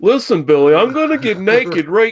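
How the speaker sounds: mock talk-show threat. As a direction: threaten the boy with a grin. Acting Dr Phil, laughing.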